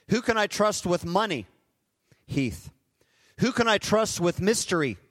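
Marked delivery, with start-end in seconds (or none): 2.2-2.7 soft answer. Acted. God answers softly by name.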